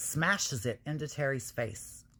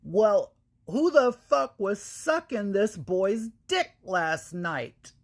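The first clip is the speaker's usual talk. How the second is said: loud mock yell across the room; retold yell, hammed up loud; yelled back; 5 semitones higher, 5 dB louder, slower than usual. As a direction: shout it across the restaurant. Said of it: "she yells back in the restaurant".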